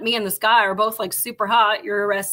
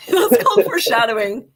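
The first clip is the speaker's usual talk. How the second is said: laughing echo; 2 semitones higher, 4 dB louder than usual. Real, not acted. Echoing a co-host with a laugh, laughing. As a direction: repeat the line through a laugh.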